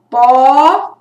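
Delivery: "Pó" as one long, loud beat; isolated syllable. Shown as a demonstration. Teacher counts the second voice emission, "Pó" loud and separate. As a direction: push out "Pó" on its own. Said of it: "eu emiti a voz três vezes".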